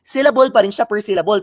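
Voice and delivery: ordinary talk, nothing marked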